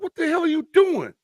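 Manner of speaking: high, exasperated coach yelling at his player; mock angry coach. Coach to player after the coin toss, high exasperated yell. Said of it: "The coach is like".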